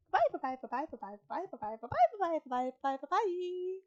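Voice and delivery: bouncy string of goodbyes, swooping high; playful rapid-fire goodbye. Pretend. Reader signing off to viewers, playful rapid-fire chant. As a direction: rattle them off, stretch the last one up high.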